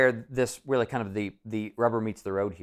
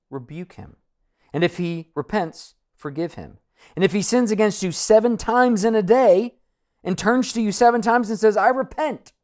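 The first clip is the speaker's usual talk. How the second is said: rising preacher read of scripture; 6 semitones higher, 7 dB louder than usual. Acted. Preacher reading Luke to the congregation, building. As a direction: read the verse with building force.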